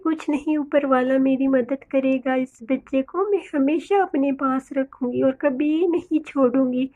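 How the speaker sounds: softly voiced protective mother bird; tender mother bird. Bird vows to care for orphan chick, tender and soft.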